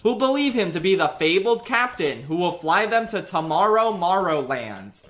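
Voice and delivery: ordinary delivery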